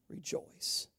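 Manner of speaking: breathy whisper, almost silent; whispered rejoice. Acted. Pastor hushing the church to a whisper. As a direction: drop to a whisper.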